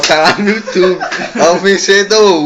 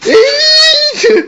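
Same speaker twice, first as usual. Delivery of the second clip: high startled yelp. Real, not acted. Slap game, reacting to hit.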